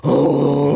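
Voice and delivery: loud imitated toilet groan; ungodly groan. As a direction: imitate a man straining noisily. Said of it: "ungodly noises".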